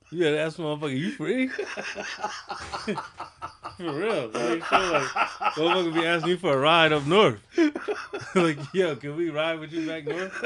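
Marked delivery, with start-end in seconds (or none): 1.0-5.1 higher, laughing through the question; can barely get it out for laughing. Real, not acted. Laughing at own joke. 5.6-10.3 laughing. Real, not acted. Laughing while joking.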